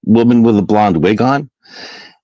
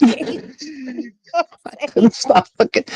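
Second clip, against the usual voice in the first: "I couldn't stop looking" squeezed out high through laughter; laughing; 4 dB quieter, much slower than usual. Real, not acted. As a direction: laugh while forcing the words out slowly. Laughing at the crazy clip.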